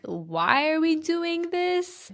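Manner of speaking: high, drawn-out judgmental question; acted side-eye disbelief; side-eye skepticism. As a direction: stretch it out slowly, pitch climbing. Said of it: "side eye".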